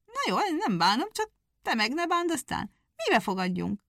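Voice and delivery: high, loud scoffing hare; mocking hare. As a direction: scoff at the little hedgehog. Hare mocks the challenge.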